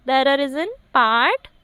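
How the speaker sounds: slow, with PART drawn out and rising; fill-in-the-blank prompt. Real, not acted. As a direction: leave PART hanging for the class to answer. Quiz prompt left for students to complete.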